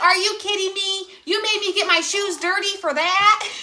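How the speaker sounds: put-on high, exasperated teen; lightly voiced fed-up daughter; annoyed daughter. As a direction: daughter complaining about dirty shoes. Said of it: "She's like".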